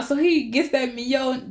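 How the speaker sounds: ordinary delivery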